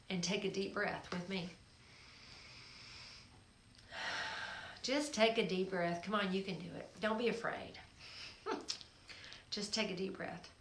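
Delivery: soft, unhurried breathing cue; guided breathing. Acted.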